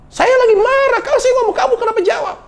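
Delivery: hammed-up husband in a rage; mock angry husband. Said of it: "refleks dia mukul".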